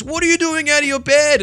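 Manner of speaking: retold doctor yelling at a wandering patient; doctor shouting. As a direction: bark it like a doctor finding a patient up. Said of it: "he goes".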